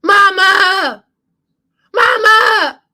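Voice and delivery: high, loud anguished scream for Mama; desperate full-voiced cry, drawn out. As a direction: scream out for your mother as you are being beaten. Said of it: "as he screams".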